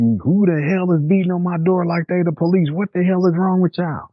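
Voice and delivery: high, indignant mother demanding answers; angry mother. Retold or imitated. Mother at her door scolding the noisy boys, high and indignant. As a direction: voice the woken mother, high and outraged.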